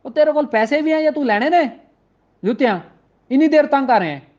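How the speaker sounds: high, irritated Punjabi shopkeeper rebuke; annoyed shopkeeper. Retold or imitated. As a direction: scold the customer for wasting time. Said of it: "दुकानदार तंग होके कहता है". (चिढ़े हुए दुकानदार की डांट)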